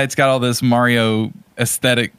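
ordinary delivery

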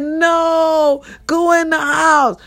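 high mock shout; mock yell. She shouts at the neighbor to get inside, high and urgent. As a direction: call out high, half-joking.

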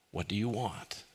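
soft, quiet asking voice, as Jesus; gentle question. Acted. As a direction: ask it softly, almost under the breath. Jesus's question quoted softly.

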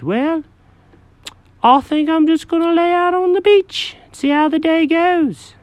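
high, fussy cat voice; Jenkins the cat. Acted.

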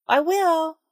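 called over his shoulder. A narrator's reading. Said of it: "Jeremy called over his shoulder".

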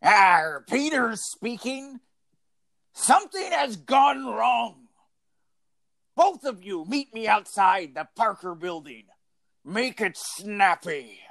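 put-on pirate accent. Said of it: "in your pirate, uh, accent".

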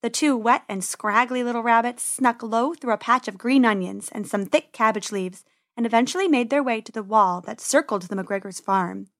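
ordinary delivery, nothing marked

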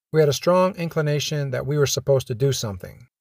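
plain reading voice

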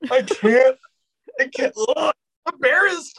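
high whiny "I'm embarrassed" wail; squirming whine. Retold or imitated. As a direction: squeal in cringing protest. Acting out cringe at Friends.

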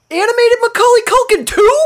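high loud amazed; excited. Pretend. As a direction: gush in amazement. Mock amazed exclamation.

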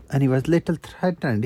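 ordinary delivery

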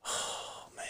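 breathy whispered "oh man"; whispered groan. Retold or imitated. Cop seeing the fight spill onto his car, whispering. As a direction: sigh it out under your breath. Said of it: "I'm like".

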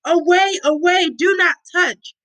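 high repeated warning cry; read-aloud shout. A narrator's reading. People warning others off the defiled, shouted warning. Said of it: "people shouted at them".

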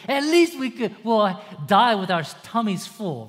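hammed-up childish complaint; whining Israelites. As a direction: whimper for a full tummy. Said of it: "they're just lusting after food".

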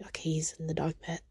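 hushed unvoiced whisper; quick whispered aside. Real, not acted. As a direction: whisper so the cat stays calm. Whispering about the cat.

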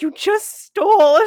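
slowly accuse, hammed up and loud; mock accusation. Theater staff catch a customer taking seasoning, mock accusing. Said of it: "they were like".

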